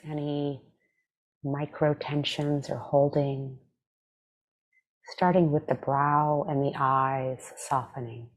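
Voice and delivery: calm, measured meditation voice with long pauses; guided meditation. Acted. Meditation teacher leading listeners through breath and body scan, calm guided meditation. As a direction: guide them gently, leaving space after each phrase.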